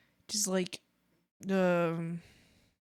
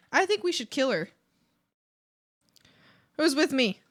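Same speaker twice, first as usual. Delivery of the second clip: higher and louder, rousing the crowd; fired-up rallying call; 6 semitones higher, 6 dB louder than usual. Acted. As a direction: whip the mob into a revolt. Rallying others to overthrow Swampy.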